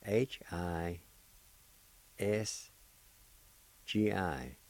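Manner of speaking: letter by letter, slow and clear; spelling out letters. Shown as a demonstration. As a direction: spell each letter distinctly. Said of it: "it's spelled like this".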